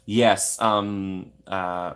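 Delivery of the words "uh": normal talking voice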